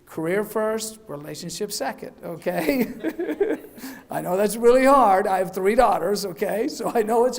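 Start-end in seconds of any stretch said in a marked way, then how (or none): 2.9-4.0 brief high chuckle; short amused laugh; light laugh. Real, not acted. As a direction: chuckle at your own advice. Laughs at the career advice joke.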